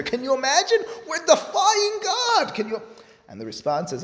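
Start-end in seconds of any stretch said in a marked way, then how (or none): 0.0-2.8 high-pitched astonished exclamation; scandalized, stretched-out high cry; incredulous outcry. Acted. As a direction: voice leaps way up in disbelief. Acted shock at defying God.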